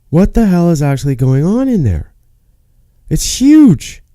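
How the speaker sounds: amazed. Retold or imitated. Patient seeing the gigantic hospital, amazed. Retold amazement at giant building.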